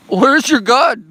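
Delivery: high, very loud taunting yell; mock maniacal yell. Streamer taunting chat in jest, escalating to a yell. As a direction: escalate into a hammed-up high shout. Same taunt repeated higher and louder.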